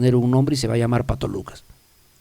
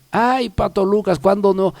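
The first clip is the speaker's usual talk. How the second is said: higher, mock-weary teasing; stretched-out opening, teasing and raised in pitch; amused dismissal; 6 semitones higher than usual. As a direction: brush off the inner critic playfully. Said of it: "yo me río y digo".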